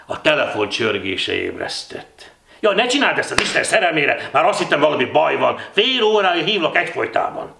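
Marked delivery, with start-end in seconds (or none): 2.6-7.5 high, loud, anxious reproach; worried scolding. A narrator's reading. Ilon on the phone to the late sleeper, worried scolding. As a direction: fret at them urgently. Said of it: "Ilon hangja tele volt aggodalommal".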